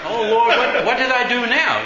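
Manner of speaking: high, loud appeal to heaven; mock dismay. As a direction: act out a flustered "what now?". Flustered man appeals to God.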